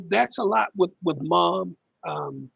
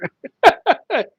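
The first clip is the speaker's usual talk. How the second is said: sudden loud high laugh; high burst of laughter; 12 semitones higher, 9 dB louder than usual. Real, not acted. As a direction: break into a real laugh. Caught off guard by comment.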